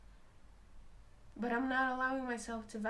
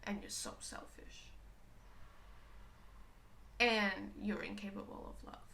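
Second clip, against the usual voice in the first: hushed cruel whisper; cold whisper; 3 dB quieter than usual. Retold or imitated. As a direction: whisper the insult. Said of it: "it would be like".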